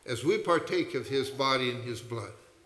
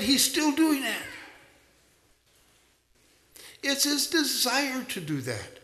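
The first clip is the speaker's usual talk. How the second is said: higher, impassioned sermon voice; a pastor's rising, heartfelt preaching; fervent preaching; 6 semitones higher than usual. Real, not acted. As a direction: preach it out to the whole congregation. Preaching at full force.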